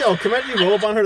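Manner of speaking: fast, loud and excited. Real, not acted. Friend to friend, picturing the scene, fast and loud. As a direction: rattle it off loud, can't believe it.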